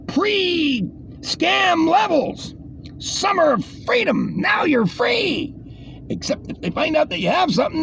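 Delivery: mock the headline in a shrill voice; sarcastic high voice. Mocking headline and sneering.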